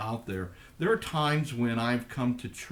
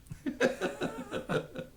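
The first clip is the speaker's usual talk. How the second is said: embarrassed laugh; 5 dB quieter than usual. Real, not acted. Laughs at own hiccups.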